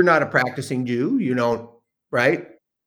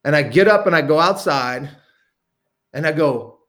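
usual speaking voice

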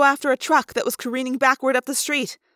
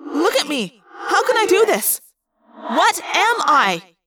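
desperate thought-speak; 4 semitones higher than usual. A narrator's reading. Frantic Rachel asking what she is, echoing desperation. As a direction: build to a drawn-out, pleading demand.

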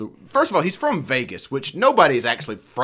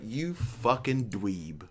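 muttered insult; 2 semitones lower, 10 dB quieter, much slower than usual. Retold or imitated. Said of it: "I was like".

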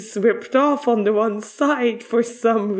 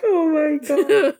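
laughing; 6 semitones higher than usual. Real, not acted. Cracking up at the joke.

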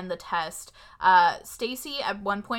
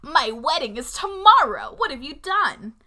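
mad mom; 4 semitones higher, 6 dB louder than usual. Retold or imitated. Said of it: "like, mad about the makeover".

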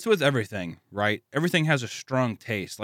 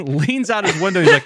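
normal talking voice

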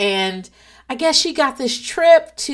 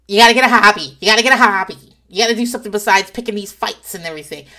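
loud nagging voicemail quote; 8 dB louder, much faster than usual. Retold or imitated. Friend's nagging voicemail, loud and preachy. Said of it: "Bethany's voicemail is, like".

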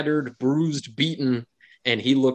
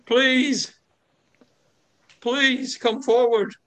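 high, slow, whimpering plea; a beaten man begging; quivering voice; 12 semitones higher, much slower than usual. Acted. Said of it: "with a quivering voice ... with a whimpering call".

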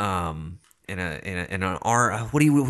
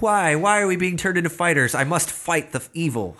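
hammed-up hero asking why; anguished robot protest; 6 semitones higher than usual. Robot turned fighter questioning its fate, melodramatic plea.

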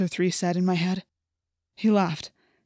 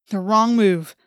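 ordinary delivery, nothing marked

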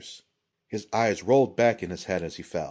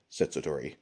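usual speaking voice

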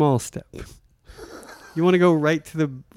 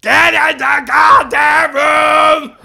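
wild, high, very slow screaming drummer; Animal Muppet; 14 semitones higher, 7 dB louder, much slower than usual. Pretend. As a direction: bellow it like a frenzied Muppet drummer. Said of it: "I'm just going to do a line as this Muppet".

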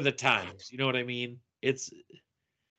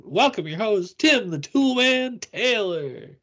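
TV announcer; 9 semitones higher, 5 dB louder than usual. Retold or imitated. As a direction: announce him like a sitcom opener. Announcing TV host intro.

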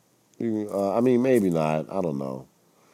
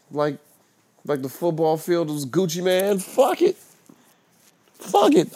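high, loud mock quarterback voice; hammed-up reckless bravado, pitched way up; Aaron Rodgers; 11 semitones higher, 5 dB louder than usual. Quarterback shrugging off a frozen field, high loud mock voice. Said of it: "but you was like".

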